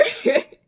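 short loud burst of laughter. Real, not acted. Laugh after story beat.

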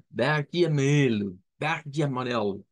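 "verde amarelo" said the Brazilian way, guttural r and ji; Brazilian pronunciation. Shown as a demonstration. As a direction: run it together as one phrase. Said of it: "ese es el concepto". ("verde amarelo" con acento brasileño)

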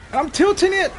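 high, loud exclamation; frantic outcry. Real, not acted. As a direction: yell it out in frustration. Frustrated as car tips.

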